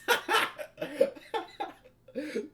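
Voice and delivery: high shriek of laughter. Real, not acted. Reacting to the story line.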